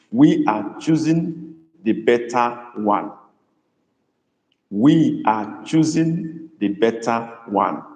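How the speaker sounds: We are choosing the better one, slowly and evenly; slow dictation. Shown as a demonstration. Teacher dictating a sentence to translate. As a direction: stretch each word for writing. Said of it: "Let's write that in Igbo".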